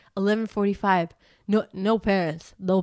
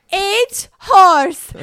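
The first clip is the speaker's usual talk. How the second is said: put-on much higher, louder kid voice; child's bad English; 10 semitones higher, 14 dB louder than usual. Daughter saying eight horse in a loud, high child voice. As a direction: mangle the English like a small child. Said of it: "Tamara's like".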